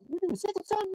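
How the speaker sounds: high, fast nosy neighbor voice; gossiping neighbor. Retold or imitated. Neighbor spreading word that a boy skipped school, high and fast. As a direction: pitch way up and rattle it off like a busybody auntie.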